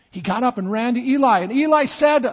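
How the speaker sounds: ordinary delivery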